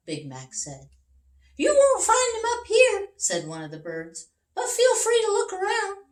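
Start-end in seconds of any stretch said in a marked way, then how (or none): 1.6-3.1 high, loud birdlike chorus voice; high birds voice; chirpy birds. Acted. Birds voiced in a read story. 4.6-6.1 high, loud birdlike chorus voice; high birds voice; chirpy birds. Acted. Birds voiced in a read story.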